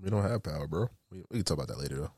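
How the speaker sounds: sick, blocked-nose voice; stuffy nose. Real, not acted. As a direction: talk through a stuffy nose. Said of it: "Sorry, I'm sick".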